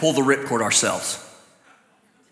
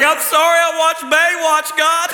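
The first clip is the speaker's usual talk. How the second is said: hammed-up terrified confession, pitched way up; panicked praying; 12 semitones higher than usual. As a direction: beg God for forgiveness mid-fall. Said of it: "I was praying hard".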